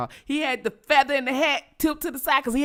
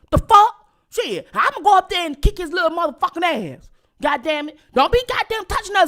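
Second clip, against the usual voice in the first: high, loud furious uncle tirade; high loud uncle chewing out mama; uncle's tirade; 9 semitones higher, 4 dB louder than usual. Retold or imitated. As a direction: uncle laying into mama, raised and heated.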